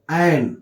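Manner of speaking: एन read alone with its full vowel; strong form. Shown as a demonstration. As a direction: say एन as "ain", not "un". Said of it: "strong form में". (एन, अकेले पूरे "ऐ" स्वर में)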